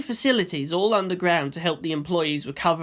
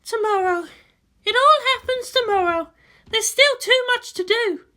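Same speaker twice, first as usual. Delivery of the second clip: much higher, louder woman's voice; a tense commander on the eve of battle; Misato's voice; 4 dB louder than usual. Acted. Misato brooding to Ritsuko about tomorrow, high and loud.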